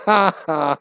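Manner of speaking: put-on mocking ha-ha; mock laugh. Gamer imitating finders laughing at the corpse, mock laugh. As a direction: laugh loudly in imitation at someone's misfortune.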